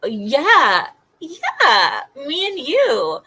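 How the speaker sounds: loud, high, slow mock cheer; sarcastic yeah. Real, not acted. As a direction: drag out "me and you" sarcastically. Sarcastic punchline.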